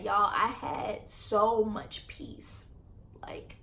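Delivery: slow, breathy whisper of relief. Real, not acted. Relief after speaking out.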